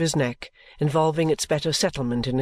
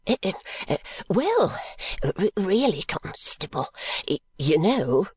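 stammering, halting lightly higher; hesitant, wistful; 5 semitones higher than usual. A narrator's reading. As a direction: stammer it out, unsure of himself.

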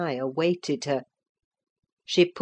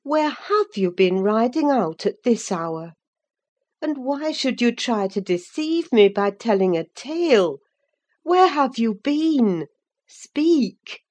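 higher-pitched, insistent interrogation; stern questioning; 6 semitones higher than usual. A narrator's reading. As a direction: press her for an answer, mildly scolding. Nurse questions girl caught sneaking home.

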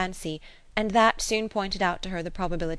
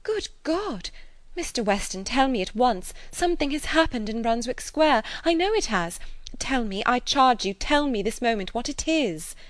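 higher, urgent, frightened demand; terrified pleading; 6 semitones higher, 3 dB louder than usual. A narrator's reading. Emma fearing news of her friends, frightened and urgent. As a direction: beg him to tell her at once. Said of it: "standing still with terror".

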